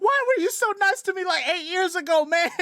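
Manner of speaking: very high, loud mock wail; wronged kid. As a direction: plead in a hammed-up hurt squeal. Voicing traumatised kid confronting the chef.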